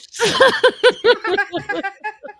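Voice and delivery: loud, high-pitched cackle; real hearty laugh. Real, not acted. Storyteller cracking up at his own prank, laughing hard.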